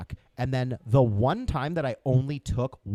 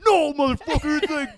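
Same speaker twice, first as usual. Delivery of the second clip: much higher and louder indignant snap; retold teen outburst; 13 semitones higher, 10 dB louder, faster than usual. Teen in the cop car yelling at the officer, high and indignant. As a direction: fire back like a defiant teenager. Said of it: "I'm like".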